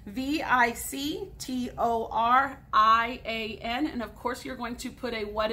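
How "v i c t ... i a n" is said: letter by letter, slow and clear; spelled out slowly. Shown as a demonstration. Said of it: "Let me spell that one for you".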